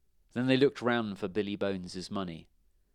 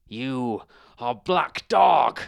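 slow, loud, shocked gasp; gasped in fear; 4 semitones higher, 11 dB louder, much slower than usual. A narrator's reading. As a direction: force the name out in horror. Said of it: "gasped Billy Bones".

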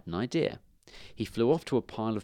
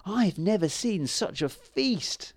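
high, slow squeak of awe; thrilled little mouse voice; 9 semitones higher, slower than usual. A narrator's reading. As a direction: squeak in drawn-out wonder. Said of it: "he squeaked".

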